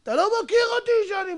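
fast, high-pitched indignant threat; acted quarrel threat. Preacher acting a hothead who starts a fight, indignant threat.

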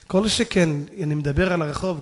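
ordinary delivery

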